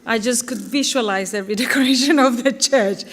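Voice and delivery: real amusement at a fond memory; amused. Real, not acted. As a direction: let a laugh break in mid-sentence. Amused by son's paintball funeral idea.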